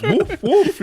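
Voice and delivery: very high drawn-out howl into yappy woofs; imitated dog bark. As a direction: mimic a man forced to bark, laughing. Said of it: "till you bark like a dog".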